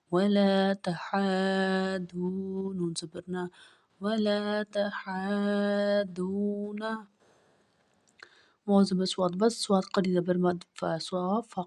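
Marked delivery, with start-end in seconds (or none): 0.1-2.7 slow, a bit louder, stretched; slow blended reading. Shown as a demonstration. Teacher joining the letters for a pupil, slow and clear. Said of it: "teacher blends spelled letters". 4.0-6.9 slow, a bit louder, stretched; slow blended reading. Shown as a demonstration. Teacher joining the letters for a pupil, slow and clear. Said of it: "teacher blends spelled letters".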